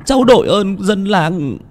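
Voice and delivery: high, tearful pleading, slowing at the end; a son pleading to find his drowned father. A narrator's reading. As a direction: beg the villagers desperately for help. Son begs villagers to find father.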